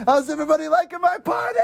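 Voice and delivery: high, fast host impression; hammed-up party host. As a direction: call out to the crowd as a mock host. Imitating villain hosting his party.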